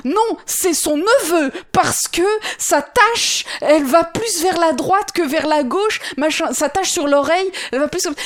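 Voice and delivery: fan qui expose sa théorie, plus fort et plus aigu; nerd de Cats. Acted. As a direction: argumente avec ferveur, en insistant sur les mots. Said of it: "nerds, de cats". (nerd passionné qui théorise)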